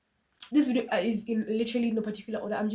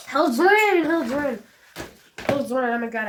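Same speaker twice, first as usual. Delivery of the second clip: high, loud urgent hold on; flustered excited stop; 5 semitones higher, 10 dB louder, slower than usual. Real, not acted. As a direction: hurry it out.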